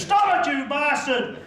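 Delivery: high-pitched yell of protest; exasperated outburst. Acted. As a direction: cry out at your teasing friend. Begs teasing friend to stop.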